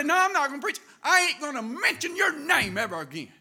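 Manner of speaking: loud, high, stubborn declaration; defiant refusal. Retold or imitated. Bitter preacher vowing to God never to preach again, loud. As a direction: slam the door on preaching. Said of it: "But this guy said".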